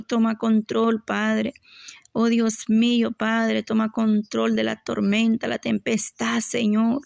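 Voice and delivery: usual speaking voice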